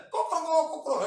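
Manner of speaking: high, loud crowing cock cock; mock rooster crow. Imitating a rooster alarm.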